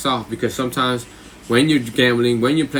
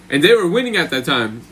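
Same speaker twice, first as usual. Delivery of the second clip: usual speaking voice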